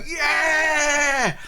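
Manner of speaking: long, high rallying yell; crowd roaring. Acted. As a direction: cheer like a riled-up mob. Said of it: "people start to go".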